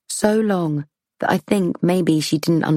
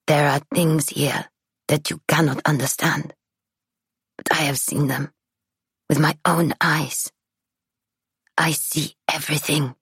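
put-on ominous old woman. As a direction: hush it like a seer's warning.

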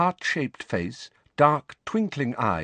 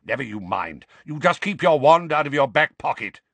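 louder gruff growl; 5 semitones higher, 5 dB louder than usual. Acted. Moody warning Harry about his pocket, growling. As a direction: grumble it as a firm order. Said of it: "growled Mad-Eye".

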